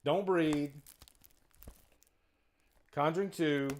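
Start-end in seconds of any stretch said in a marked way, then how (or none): none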